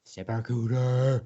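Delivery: high-pitched chant, stretching out longer each time; silly drawn-out word repeated over and over; mock obsessive repetition. Host imagining him driving around saying it, silly repeated chanting. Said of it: "driving around and going".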